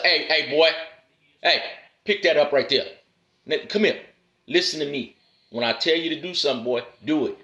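firm father disciplining his son; stern father to son. Shown as a demonstration. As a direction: bark orders at your boy. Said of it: "When you talk to your son".

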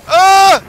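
sudden loud, high yelp; short high scream; startled yell. Real, not acted. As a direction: cry out as if caught by a monster. Startled while running from the snake.

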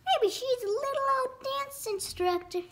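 high mother's voice; mother turkey. A narrator's reading. Mother guesses who the old lady is, high voice. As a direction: speak high as hopeful mother.

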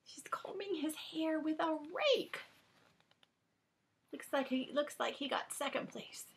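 hushed whispered aside. Real, not acted. Whispering to the listener about the picture of the dog show.

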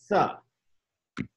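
"Th" with the tongue against the upper teeth; th sound. Shown as a demonstration. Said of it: "tenemos que tocar la lengua con los dientes".

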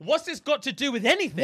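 high-pitched incredulous question; acted bafflement. Son baffled by the video he was sent, high-pitched confusion.

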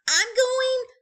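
high, loud call while leaving. Acted. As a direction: call back as you run out. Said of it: "called Franklin".

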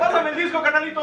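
loud, high hype call; excited call. Real, not acted. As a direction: call out like a radio host. Host calls for the disco.

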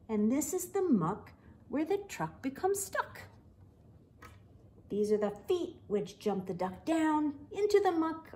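lively, animated bedtime story reading; picture book read-aloud with voices; reading to children. A narrator's reading. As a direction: read it bright and playful for little kids.

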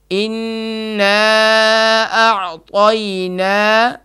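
انا اعطینا very slowly drawn out in a chant; slow recitation. Shown as a demonstration. Teacher shows the rushing student how slowly to recite. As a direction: take it slowly, no hurry. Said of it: "آرام آرام سے پڑھیں".

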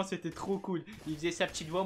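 usual speaking voice

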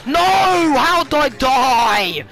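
loud, high cry, stretched out; outraged disbelief. Real, not acted. Gamer asking how he died, outraged wail.

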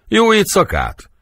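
very high incredulous question; indignant echo. Acted. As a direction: throw the suggestion back, pitched far up. Indignantly echoes the suggestion. (felháborodott, magas hangú visszakérdezés)

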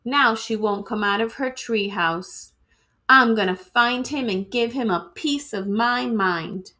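plain reading voice

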